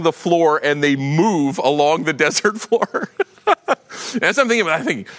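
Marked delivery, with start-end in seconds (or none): 3.5-4.1 short high laugh; a quick amused laugh up high; high burst of laughter. Real, not acted. Pastor amused by creeping desert plants, high laugh.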